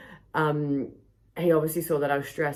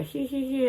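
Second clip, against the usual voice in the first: mock giggle; 10 semitones higher than usual. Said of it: "and then they".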